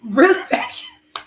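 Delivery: slow mocking repeat; mocking echo. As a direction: throw his line back at him, teasing. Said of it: "say it like how you say it".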